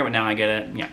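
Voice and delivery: normal talking voice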